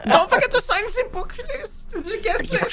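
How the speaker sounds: high, giggling farewell to guests; a mock-cheery host through laughter; laughing host.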